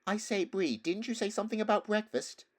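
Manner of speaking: put-on high eager boy voice; bright hopeful young lad asking. A narrator's reading.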